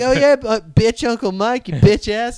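high-pitched cussing little tough guy; imitated gangster nephew. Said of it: "like, gangster sometimes, my nephew".

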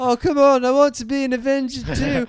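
high, whiny plea to be included; Stephen Merchant. Acted. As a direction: whine like a left-out tagalong. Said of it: "Stephen Merchant. How did you become Vision?".

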